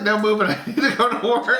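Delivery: high laughing imitation of the wife.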